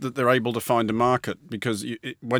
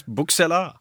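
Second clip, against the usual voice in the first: put-on bookseller said with a playful French accent, a bit higher; mock French accent; 5 semitones higher than usual. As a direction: say bookseller the French way, tongue in cheek. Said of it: "the French word for bookseller".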